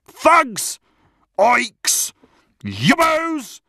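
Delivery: high yells from the touchline; shouted abuse. Acted. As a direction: hurl each insult as a separate shout. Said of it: "the chorus of abuse from the touchline".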